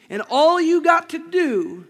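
loud, high and slow; slow loud preaching. Acted. Preacher stressing a point to the church, slow and loud. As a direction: lean in and stretch it out.

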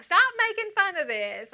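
high, loud mock protest. Friend scolding her for joking about prayer, high loud squeal. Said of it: "And she's like".

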